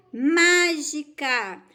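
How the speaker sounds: "Mágica" said very slowly, leaning loudly on MÁ; drawn-out stressed syllable. Shown as a demonstration. Teacher helping pupils find the strongest syllable, "Mágica" slow and emphatic. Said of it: "Qual é a mais forte?".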